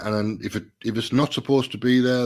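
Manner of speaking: ordinary talk, nothing marked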